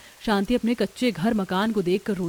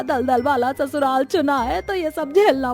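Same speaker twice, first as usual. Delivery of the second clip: the aunt taunting the muddy bride, laughing; mocking laughter; 3 semitones higher than usual. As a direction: sneer while laughing at her. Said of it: "हंसी उड़ाती है".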